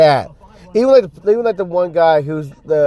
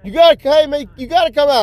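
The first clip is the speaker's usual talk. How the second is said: high-pitched imitation of a yelling manager; hammed-up, frantic calling; mock yelling; 10 semitones higher than usual. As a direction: holler after him in a high mock voice. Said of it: "he just yelling and screaming like".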